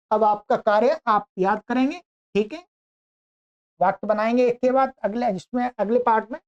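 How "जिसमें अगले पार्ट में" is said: ordinary talk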